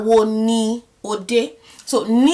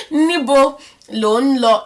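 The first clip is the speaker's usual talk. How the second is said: mock stern; 3 semitones higher, 4 dB louder, slower than usual. Mock stern teacher scene.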